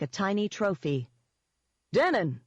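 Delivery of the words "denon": a raised, higher hail; mild shout. A narrator's reading. Eden hailing Denon on the phone, mildly shouted. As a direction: call out the name heartily, understated shout. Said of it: "he shouted".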